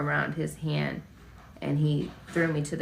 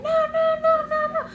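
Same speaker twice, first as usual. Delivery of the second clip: very high, loud, repeated cries; reenacted hysteria; 6 dB louder than usual. Frozen girl refusing her uncle, hysterical high cries. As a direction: imitate a hysterical child refusing in panic. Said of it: "went hysterical".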